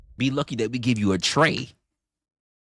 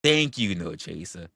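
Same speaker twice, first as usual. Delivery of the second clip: normal talking voice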